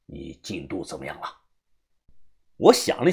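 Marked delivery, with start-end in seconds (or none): 0.1-1.4 put-on very low, quiet old man's voice; old boss. Old man asking his underling about progress, low and quiet. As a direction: speak low and soft as an elder in charge.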